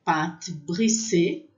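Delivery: pâte brisée said slowly in careful French; French pronunciation. Shown as a demonstration. Teacher modeling pâte brisée for students in French. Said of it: "A gente tem a".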